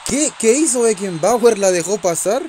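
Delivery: high, loud, stunned question; can't believe what just happened; shocked disbelief. Real, not acted. Reacting in disbelief to a goal.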